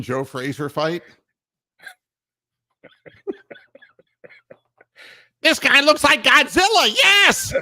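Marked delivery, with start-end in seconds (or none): 5.4-7.5 high, loud excited play-by-play; sportscaster call. Retold or imitated. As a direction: shout it like a boxing announcer. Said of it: "Could you imagine if Al Michaels called".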